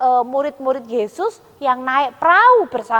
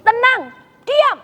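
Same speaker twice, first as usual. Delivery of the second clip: commanding calm; 7 semitones higher, slower than usual. Acted. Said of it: "dia berkata begini".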